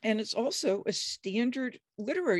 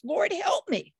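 high, loud begging cry; loud high-pitched plea for help; desperate plea; 12 semitones higher, 4 dB louder, slower than usual. Acted. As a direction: plead from your knees. Woman kneeling, begging Jesus.

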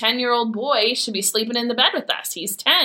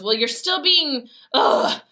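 higher-pitched retort ending in a disgusted groan; exasperated teenager; 7 semitones higher than usual. Retold or imitated. Mom imitates her son before he storms off, exasperated. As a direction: mimic a fed-up teen giving up mid-sentence. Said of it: "And he stormed off".

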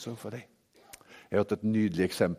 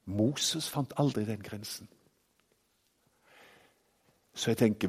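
ordinary delivery

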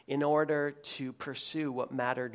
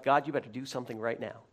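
impatient prayer; 5 semitones lower, much faster than usual. Retold or imitated. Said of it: "where you're like".

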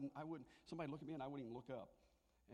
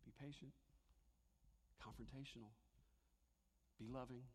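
gentle inner voice; 5 semitones lower, 13 dB quieter than usual. Retold or imitated. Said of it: "the Holy Spirit says".

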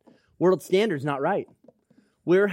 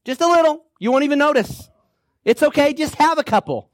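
high, louder sing-song coaxing; coaxing tempter; 9 semitones higher, 5 dB louder than usual. Acted. Tempter offering the tainted brownie, high coaxing. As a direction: sweet-talk them into one bite.

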